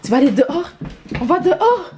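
high excited French to a dog; excited pet talk. Real, not acted. Owner tells her dog they are going outside, excited pet talk. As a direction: rouse the pet, pitch climbing.

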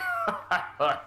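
high, amused chuckle at the initiates; guard's chuckle. Acted. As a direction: chuckle at their failed attempt. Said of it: "he chuckles".

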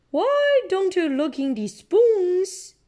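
high, slow playful voice for Daddy; a bright put-on voice, drawn out; Daddy Pig voice. A narrator's reading. Parent reading, voicing Daddy Pig high and slow. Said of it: "suggests Daddy Pig".

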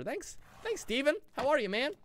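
usual speaking voice

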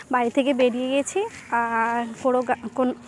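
usual speaking voice

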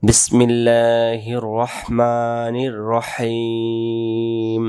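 very slow, drawn-out tajweed chant; correct recitation. Shown as a demonstration. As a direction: recite it whole, stretched out. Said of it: "তাহলে আমরা বলবো".

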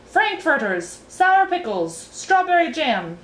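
high, shouted list; Officer Shrift. A narrator's reading. Officer giving his speech from his chair, shouting. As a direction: shout each dish out separately. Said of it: "shouted Officer Shrift".

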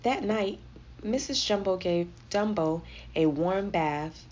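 plain reading voice

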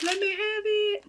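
high squeaky mock plea; playful high-pitched demand; eager grab. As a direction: beg for it in a squeaky voice.

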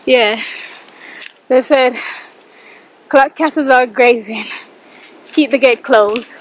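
usual speaking voice

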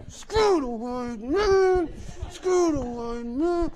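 high, drawn-out strangled chant; loud chant forced out like a clumsy ventriloquist; bad ventriloquist. Shy storyteller joins the chant, bad ventriloquist voice. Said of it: "like a bad ventriloquist act".

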